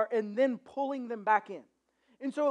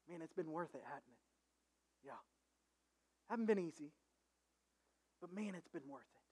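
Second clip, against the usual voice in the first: much softer and lower, intimate; hushed reminiscing; 8 semitones lower, 16 dB quieter than usual. Acted. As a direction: murmur it like an old friend. Jesus quietly reminisces with Peter.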